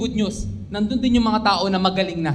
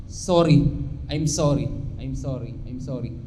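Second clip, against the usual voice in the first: softer and lower with each sorry; sincere apology; 7 semitones lower, 4 dB quieter than usual. Real, not acted. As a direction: let each sorry sink quieter. Pastor apologizes to hurt churchgoers.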